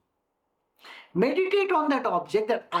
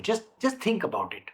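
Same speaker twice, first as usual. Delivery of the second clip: ordinary talk, nothing marked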